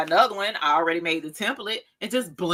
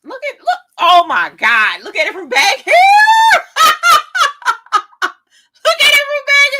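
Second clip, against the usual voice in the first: squealing; 11 dB louder than usual. Real, not acted. Squealing over the finished look.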